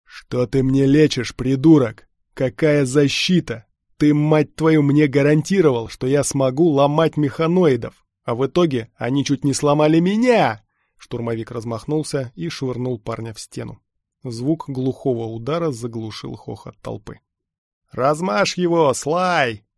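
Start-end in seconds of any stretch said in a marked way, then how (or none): 0.0-10.6 loud, heavy outburst of anger; angry berating. A narrator's reading. Stormtrooper blames the lad for failed software, angrily berating. 17.9-19.6 high, loud, drawn-out yell; shout from the crowd. A narrator's reading. Someone in the crowd eggs on the stormtrooper, shouting. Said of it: "выкрикнул кто-то".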